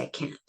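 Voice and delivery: "I can't" ending in a glottal stop, soft; "can't" with no t heard; throat stop. Shown as a demonstration. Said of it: "I'm stopping in my throat this time".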